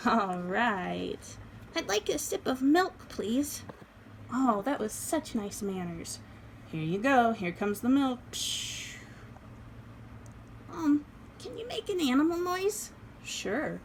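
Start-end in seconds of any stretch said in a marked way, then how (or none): 1.8-3.6 doll voice. Acted. Doll asks for milk. 10.7-12.9 doll voice. Acted. Doll asks for noise.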